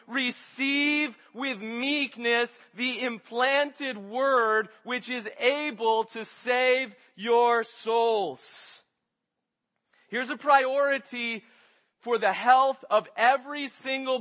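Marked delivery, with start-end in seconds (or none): 0.1-8.8 very slow, each phrase weighted; emphatic scripture quote. Acted. Preacher delivers the key verse to the congregation, slow and weighty. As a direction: draw the verse out slowly in full voice.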